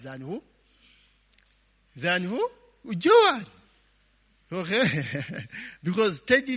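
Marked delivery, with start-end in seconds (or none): 3.0-3.5 loud answer. Real, not acted. Congregation answers pastor's question.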